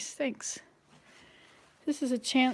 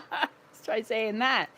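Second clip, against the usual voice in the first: laughing; much slower than usual. Real, not acted. Still laughing after twister.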